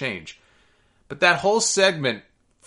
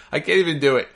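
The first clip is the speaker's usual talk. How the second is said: ordinary talk, nothing marked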